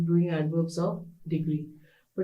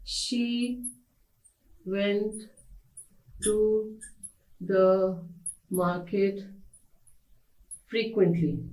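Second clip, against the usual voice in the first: each word separated by long pauses; word-by-word reading; much slower than usual. Shown as a demonstration. Teacher dictating the example sentence to students. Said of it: "one sentence like this".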